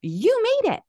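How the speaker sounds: nada tinggi dan bersemangat, seperti menemukan sesuatu; excited discovery. Retold or imitated. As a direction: seru tirukan gadis resepsionis yang kaget senang. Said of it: "She goes".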